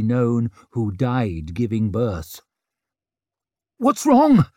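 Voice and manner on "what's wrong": high, loud panicky question; stammered alarm. A narrator's reading. Father against the wall by the bed, alarmed stammer. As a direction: blurt it out, frightened and helpless. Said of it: "stammered Leo".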